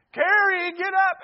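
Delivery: high, loud mom yell; yelling up the stairs. Retold or imitated. Mom calling a sleeper upstairs, yelling. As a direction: holler up to a kid who won't get up. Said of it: "she would yell up the stairs".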